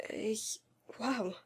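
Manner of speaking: whispered in disbelief; breathed whisper. A narrator's reading. Boy stunned by friend's news, whispering. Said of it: "hauchte ich".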